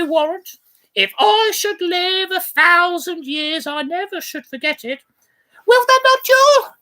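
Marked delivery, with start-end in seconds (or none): 5.6-6.7 put-on higher and louder teasing the child; merry husband teasing. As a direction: coax the little girl, playfully high. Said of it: "quoth he".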